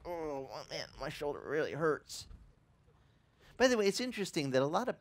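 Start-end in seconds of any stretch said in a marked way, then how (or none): 0.0-2.3 soft, groaning complaint; quiet pained grumble; aching shoulder. Pretend. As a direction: moan like the hurt shoulder itself. Voicing the sore shoulder.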